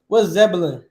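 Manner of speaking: usual speaking voice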